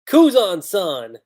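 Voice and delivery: loud, drawn-out call of the name; fan cry. Real, not acted. Fan cheering for Kuzan, loud and slow.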